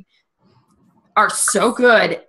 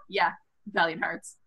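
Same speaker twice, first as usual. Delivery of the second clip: ordinary talk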